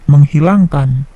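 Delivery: normal talking voice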